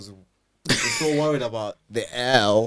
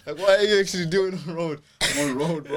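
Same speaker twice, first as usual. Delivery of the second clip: laughing mock roadman; 6 semitones higher, 10 dB louder than usual. Acting out applicant and bank.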